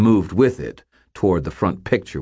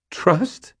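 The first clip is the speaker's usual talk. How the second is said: high, rising disbelief; startled, high-pitched query; incredulous question; 13 semitones higher than usual. A narrator's reading. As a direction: echo the word in bewilderment. Frightened boy questions his guardian.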